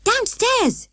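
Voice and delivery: high excited shout. A narrator's reading. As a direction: call it out eagerly. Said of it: "shouted Morgan".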